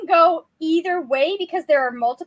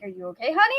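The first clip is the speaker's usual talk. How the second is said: put-on a lower, gently worried older gentleman; concerned old man; 7 semitones lower, much faster than usual. Older coworker asking her, lightly voiced concern. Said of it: "he's like".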